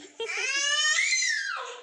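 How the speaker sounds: high, loud squealing laugh; delighted laugh. Real, not acted. Baby-directed laugh. (खूप उंच मोठ्याने आनंदी हसणे)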